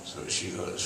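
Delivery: normal talking voice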